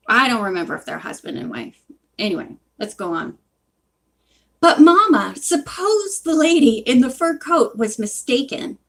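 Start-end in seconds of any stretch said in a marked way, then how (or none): 4.6-8.8 raised, pleading shopkeeper; pitched-up character protest; persistent protest. A narrator's reading. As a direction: object insistently to the wife. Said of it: "persisted the proprietor".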